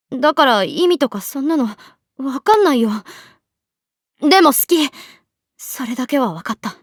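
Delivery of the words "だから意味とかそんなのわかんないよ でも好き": louder, pushing the words out; insisting her feelings are real; emotional outburst. Acted. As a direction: confession spilling over. Confession bursting out.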